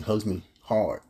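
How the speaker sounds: ordinary delivery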